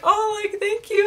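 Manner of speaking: high, flattered retold thanks; gushing thanks. Re-enacting thanks for the compliment.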